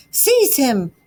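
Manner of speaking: a hammed-up call to seize; alarmed cry. A narrator's reading. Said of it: "they cried".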